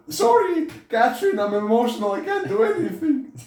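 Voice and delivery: shrieking parody of a man apologising to his wife; squeaky mock whine. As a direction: overdo the helpless guy into a squeal. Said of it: "I'm scared".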